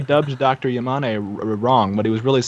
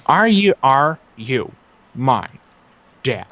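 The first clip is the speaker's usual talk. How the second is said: slow dramatic reading; slow put-on voice; slower than usual. Shown as a demonstration. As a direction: draw it out in a put-on voice. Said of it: "in that voice".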